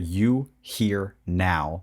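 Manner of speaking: slow, spaced-out words; drawn-out caption. Retold or imitated. Reminding listeners of an old Tumblr gif, drawn out. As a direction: stretch each word out, one at a time. Reciting the gif's caption dramatically.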